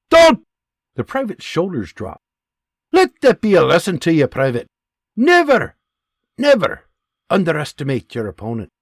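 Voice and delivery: loud, high stern Scottish lesson; Scottish sergeant lecturing. Acted. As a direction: lecture him sharply.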